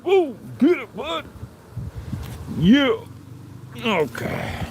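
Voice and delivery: high excited urging of the dog; dog talk. Real, not acted.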